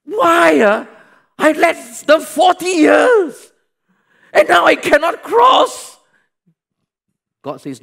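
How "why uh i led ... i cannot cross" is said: much higher, loud anguished protest; pleading shout. Acted. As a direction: shout the plea at God. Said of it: "but Moses pleaded".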